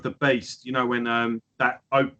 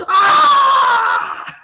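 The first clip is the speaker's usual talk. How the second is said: very high, loud ecstatic scream; scream of joy; 11 dB louder than usual. Retold or imitated. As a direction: let out a huge shriek of delight. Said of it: "I just fucking screamed in the fucking corridor".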